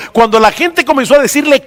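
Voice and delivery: ordinary delivery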